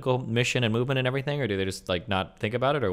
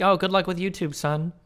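lightly voiced parent, higher pitched; mock supportive parent; 7 semitones higher than usual. Co-host imagining parents okay with YouTube, mock supportive.

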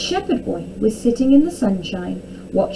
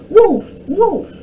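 high raised call of alarm; calling out wolf; 11 semitones higher, 8 dB louder than usual. A narrator's reading. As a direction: cry out a warning. Demonstrating the shout.

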